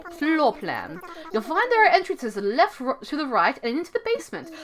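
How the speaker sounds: put-on British accent, swooping up and down. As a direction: play a hooting, well-mannered owl with a British accent. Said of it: "give him some kind of British accent".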